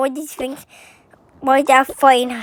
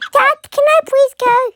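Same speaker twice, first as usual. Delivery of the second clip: high, louder pretend begging; pleading child; 9 semitones higher, 5 dB louder than usual. Child asking dad to go, high pleading.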